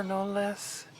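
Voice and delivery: normal talking voice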